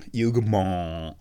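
slow, drawn-out mock French; imperfect French accent, last vowel lingering; attempted French accent. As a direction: stretch Hugomont out in a stuffed-up French accent. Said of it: "I still can't do it quite right".

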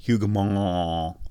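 slow, drawn-out mock French; imperfect French accent, last vowel lingering; attempted French accent. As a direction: stretch Hugomont out in a stuffed-up French accent. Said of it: "I can't do my French accent today".

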